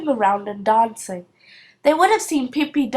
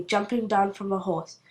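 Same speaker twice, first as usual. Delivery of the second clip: ordinary delivery, nothing marked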